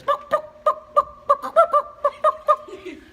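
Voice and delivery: very high, loud staccato clucking; chicken clucks. Acted. Chicken gets the librarian's attention, high loud clucking. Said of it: "And the chicken says".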